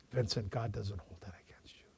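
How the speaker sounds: soft, gentle whisper of comfort; reassuring whisper. Retold or imitated. Pastor whispers forgiveness to a weeping old man. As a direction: breathe it tenderly to calm him.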